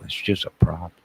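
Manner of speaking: usual speaking voice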